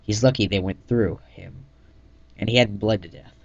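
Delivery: plain reading voice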